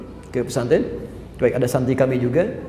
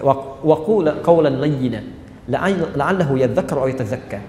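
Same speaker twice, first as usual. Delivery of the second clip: slow, measured recitation of the verse; Quran recitation; 3 semitones lower, slower than usual. Acted. Preacher quotes the Quran to the congregation, slowly recited.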